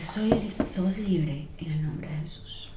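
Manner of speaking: very slow, hushed proclamation; slow whisper. Retold or imitated. The mother-in-law whispering she is free. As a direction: breathe it out as a blessing. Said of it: "Y dice".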